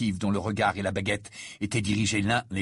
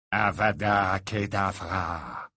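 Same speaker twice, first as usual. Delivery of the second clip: 1 cold curse; 3 semitones lower, much slower than usual. A narrator's reading. Snape casts killing curse.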